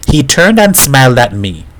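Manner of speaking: ordinary delivery, nothing marked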